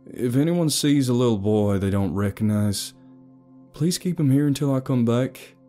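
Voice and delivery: worried father's plea; urgent appeal. A narrator's reading. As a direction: appeal to everyone within earshot.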